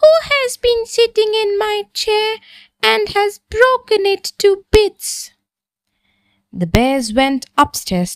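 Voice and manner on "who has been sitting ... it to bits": very high, gasping little bear voice; high, breathless baby bear voice; squeaky Baby Bear. A narrator's reading. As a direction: squeak it out like a tiny shocked bear cub. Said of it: "Baby Bear gasped".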